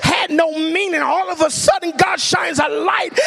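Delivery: high, fired-up preacher's shout; carried away with real excitement, stretching into a shout; excited preaching. Real, not acted. Preacher telling church how hope returns, excited yelling. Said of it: "I get excited".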